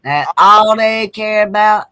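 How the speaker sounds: slow, loud, weighing every word; slow and pointed, repeating the complaint; emphatic slow. Real, not acted. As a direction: drive the point home about money. Bitter agreement about family and money.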